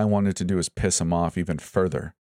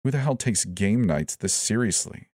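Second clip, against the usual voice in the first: ordinary delivery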